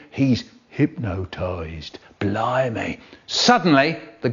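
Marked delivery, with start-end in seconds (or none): 0.1-3.0 whispering. Acted. Kids watching, awed hush.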